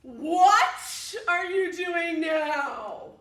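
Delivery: loud, drawn-out demand; chicken's slow angry shout. Acted. Chicken demanding what the cows are doing. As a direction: stretch out the outrage.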